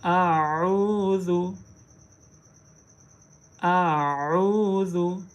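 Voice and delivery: "आऊज़ु" very slow, ain pressed from the throat; clear ain sound. Shown as a demonstration. Teacher models the ain for students, very slowly. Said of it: "हमज़ा और आइन की आवाज़ के दौरान फ़र्क".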